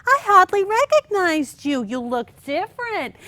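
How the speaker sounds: a lightly voiced fake-surprised neighbour; imitating Margot. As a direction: gush at her friend like a nosy acquaintance. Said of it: "and Margot said".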